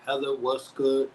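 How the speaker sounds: ordinary talk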